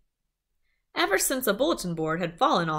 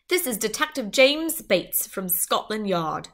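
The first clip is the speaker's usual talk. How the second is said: put-on British accent; 3 semitones higher than usual. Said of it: "It also had an accent".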